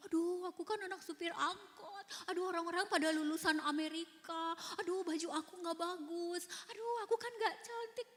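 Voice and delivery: mock self-pity, pitched high; self-pitying inner voice. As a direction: moan about not measuring up. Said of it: "Jadi saya selalu merasa".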